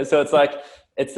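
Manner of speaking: normal talking voice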